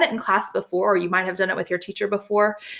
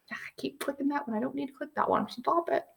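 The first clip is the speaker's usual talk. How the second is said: softer annoyed muttering to herself; muttered aside; 5 semitones higher, 11 dB quieter than usual. Real, not acted. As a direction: grumble at the clicker under your breath. Clicking wrong button, muttering.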